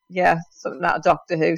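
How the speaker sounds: usual speaking voice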